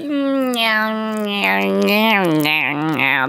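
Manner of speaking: put-on Valley Girl accent drawling yeah; drawn-out Valley Girl yeahs, sliding down. Shown as a demonstration. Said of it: "Valley Girl accent".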